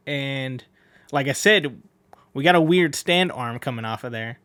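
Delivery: ordinary talk, nothing marked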